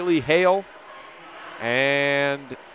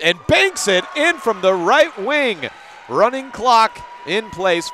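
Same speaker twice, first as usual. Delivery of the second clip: higher, louder, slower call of the bank shot; excited call; 6 semitones higher, 4 dB louder, slower than usual. Real, not acted. Announcer calling a banked shot to listeners, excited. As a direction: let it ring out as the shot drops. Big shot banks in, lead grows.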